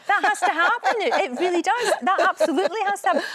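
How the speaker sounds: high, loud and laughing, speeding up; excited urging. Real, not acted. Host urging Rita to work with them, thrilled and laughing. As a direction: insist with delighted excitement. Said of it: "urging Rita excitedly".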